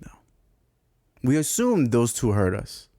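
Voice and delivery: ordinary talk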